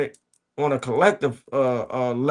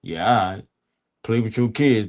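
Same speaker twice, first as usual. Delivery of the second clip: much lower, laid-back street voice; D-Ray voice; 5 semitones lower than usual. Acted. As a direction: drop low and cool like a homeboy. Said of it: "in my D-Ray voice".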